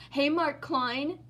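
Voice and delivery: ordinary talk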